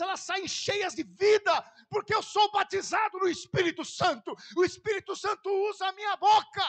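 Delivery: loud, rising, fervent preaching; preaching at full force. Acted. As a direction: shout it out to the congregation.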